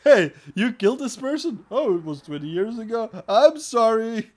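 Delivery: loud, high-pitched sarcastic role-play; mock excusing exchange. Host mocking time limits on murder cases, sarcastic loud role-play. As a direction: act out an absurdly easy pardon, exaggerated.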